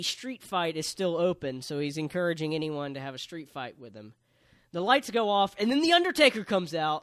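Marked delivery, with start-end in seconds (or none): 4.7-7.0 raised, louder hype; hyped-up joke announcement; mock big reveal. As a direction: sell a fake surprise entrance. Fake reveal told as joke.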